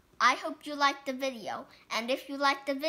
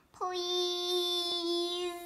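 begging please; 5 semitones higher, 3 dB quieter than usual. Acted. Pigeon begs.

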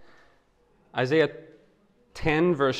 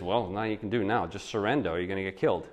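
quiet blunt warning; 6 semitones lower, 8 dB quieter, faster than usual. Retold or imitated. Jeremiah answers the frightened people.

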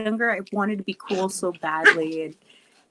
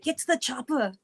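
Austrian accent, higher and playful; Arnold Schwarzenegger; 4 semitones higher, 4 dB quieter than usual. Shown as a demonstration. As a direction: do a jokey Austrian action-hero accent. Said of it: "Can you do an Arnold Schwarzenegger accent?".

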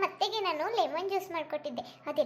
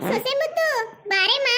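louder coaxing call; 7 semitones higher, 8 dB louder than usual. Acted. Calls again, higher and louder.

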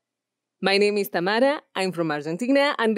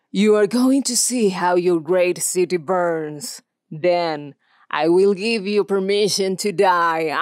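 slow, louder menacing threat; menacing villain; 3 dB louder than usual. Shown as a demonstration. Villain threatening to burn the great city, slow and menacing. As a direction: savour every word of the threat.

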